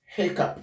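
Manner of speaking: "hiccup" ending in a crisp p sound; correct hiccup. Shown as a demonstration. Teacher models a tricky gh spelling, hiccup ending in p. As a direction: say "hiccup" as HEK-up, plain p at the end. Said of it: "This word is pronounced hiccup".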